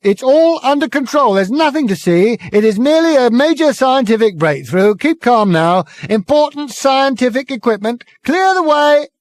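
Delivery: high, loud fake-official calm; megaphone bawling. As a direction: reassure the crowd by yelling. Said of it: "bawling at the crowd through it".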